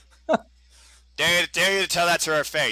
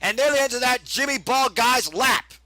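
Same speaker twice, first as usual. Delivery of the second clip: play-by-play; 7 semitones higher than usual. Acted. Live play-by-play of the match.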